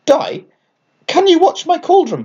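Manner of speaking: a cheery little witch asking a favour; high sing-song request; Dot asking. A narrator's reading. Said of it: "Dot spoke to her".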